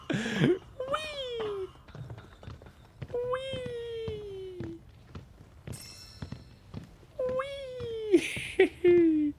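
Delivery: high, gleeful squeal; playful wee. Pretend. Gamer squealing along with the game, high gleeful wee. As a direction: squeal it like a kid on a slide.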